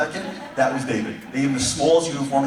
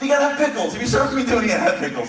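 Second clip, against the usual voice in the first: high fussy complaint; 12 semitones higher, 4 dB louder, faster than usual. Retold or imitated. Soldiers complain about lunch.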